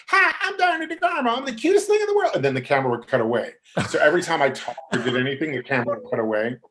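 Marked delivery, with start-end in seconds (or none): 0.1-2.3 very high, cutesy sketch impression. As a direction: chirp it sky-high, hammed-up cute. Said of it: "I kept doing this thing".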